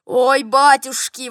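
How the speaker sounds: loud, drawn-out wail of pain. A narrator's reading. General in toothache agony, wailing. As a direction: howl it slowly and loudly. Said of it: "вопил он".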